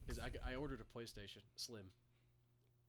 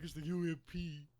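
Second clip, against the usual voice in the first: higher, louder, slowed-down mimicry; mocking friend imitation; 5 semitones higher, 6 dB louder than usual. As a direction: drag it out in a put-on voice. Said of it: "And you're like".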